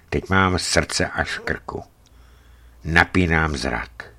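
plain reading voice

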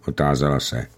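ordinary delivery

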